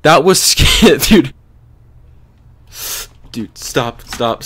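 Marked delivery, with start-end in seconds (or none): 0.0-1.3 nervous laugh. Real, not acted. Laughing after scare.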